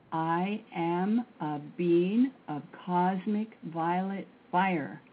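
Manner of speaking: slow, deliberate mantra chant; said slowly. Shown as a demonstration. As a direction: draw out every word so others can follow. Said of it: "I'll say it slowly".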